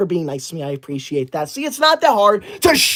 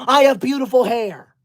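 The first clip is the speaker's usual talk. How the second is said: ordinary delivery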